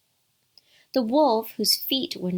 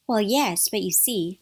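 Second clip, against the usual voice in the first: plain reading voice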